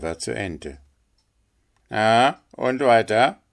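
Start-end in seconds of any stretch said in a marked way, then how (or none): 1.9-3.4 loud, higher water-rat voice; impatient water rat. Acted. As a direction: demand the rest of the tale loudly. Water rat prompts the linnet. (ungeduldige Wasserratte, laut und höher)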